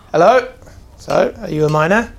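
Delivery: startled, then press the question; surprised dwarf voice. Acted. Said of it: "And he kinda like".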